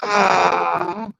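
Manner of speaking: high, crying whimper; a small high cry of worry; anxious whimper. Real, not acted. Painter whimpers over the delicate stroke, high and tearful. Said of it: "Yes, I'm making noise".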